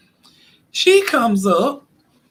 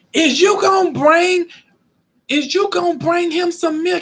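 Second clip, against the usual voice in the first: grandmother demanding; 11 semitones higher than usual. Retold or imitated. Grandmother pressing Romeo for the milk, high insistent demand. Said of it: "So she say".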